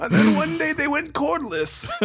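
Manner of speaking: put-on high old man voice. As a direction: croak it like a grandpa. Continuing old man joke.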